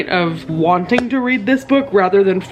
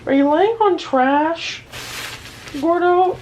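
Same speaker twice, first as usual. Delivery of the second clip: put-on baby talk to pet; 8 semitones higher than usual.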